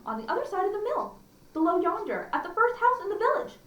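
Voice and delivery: put-on a small girl giving eager directions; Little Red's voice. Little Red telling the wolf the way, high and eager.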